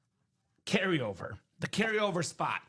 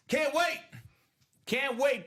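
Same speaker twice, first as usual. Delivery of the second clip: Bart Scott impression; 7 semitones higher, slower than usual. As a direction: shout it like a hyped coach. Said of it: "In the words of Bart Scott".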